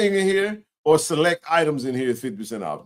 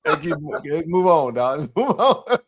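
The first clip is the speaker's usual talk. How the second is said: laughing. Real, not acted. Laughing off the joke.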